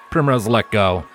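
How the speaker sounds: ordinary delivery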